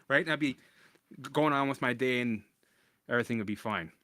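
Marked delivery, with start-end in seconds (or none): none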